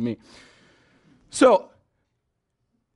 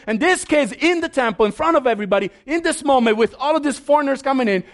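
high, loud, indignant priests; outraged priests; 10 semitones higher, 4 dB louder than usual. Retold or imitated. Priests confronting Jesus about the children, indignant and high. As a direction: hammer Jesus with alarmed outrage. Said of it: "they're like".

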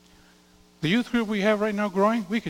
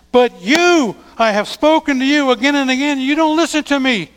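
higher, louder voice of an exasperated God; God's frustration; 7 semitones higher, 10 dB louder than usual. Acted. Preacher voicing God fed up with his people, raised and loud. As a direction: let God's frustration ring out. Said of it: "You can almost hear, see God's frustration".

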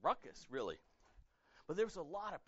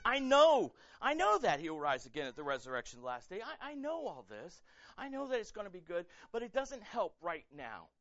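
pained insistence; 3 semitones higher, 7 dB louder than usual. Acted. Martha's grieving reply.

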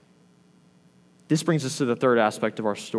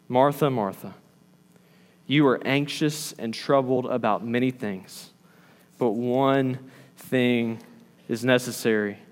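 lower, unhurried, tender correction; gentle reply; 3 semitones lower, 4 dB quieter than usual. Acted. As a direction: answer gently, with quiet patience. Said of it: "he responds with gentleness".

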